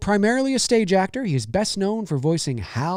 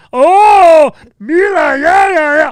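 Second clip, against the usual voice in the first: very high, loud mock yell; hammed-up excited squeal; Pee-wee shout; 12 semitones higher, 13 dB louder, slower than usual. Streamer imitates Pee-wee yelling, high and loud. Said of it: "the whole".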